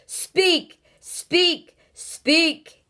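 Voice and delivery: loud, high repeated speak; fearful shout. Acted. Houston shouts at the silent strangers, frightening shout. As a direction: rattle their eardrums with it. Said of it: "getting louder and louder ... frightening shout".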